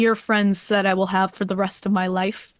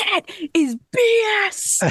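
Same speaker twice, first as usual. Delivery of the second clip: much higher, drawn-out mock yell; passionate fan's outburst; 12 semitones higher than usual. Grandmother pointing at the game, imitated high-pitched indignant yell. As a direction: imitate a boisterous heckler calling out. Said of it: "she would stand, point, and go".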